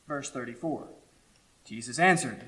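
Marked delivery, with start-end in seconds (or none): none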